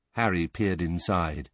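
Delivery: ordinary delivery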